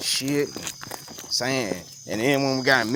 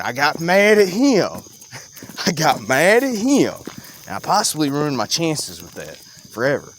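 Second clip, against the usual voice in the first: ordinary delivery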